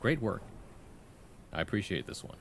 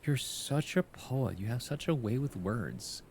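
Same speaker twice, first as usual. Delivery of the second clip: slow, higher gushing praise; mock admiring fan; 3 semitones higher, slower than usual. Imagined friend praising the poet, slow gushing awe. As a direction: gush over the poem like an awed friend. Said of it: "be like".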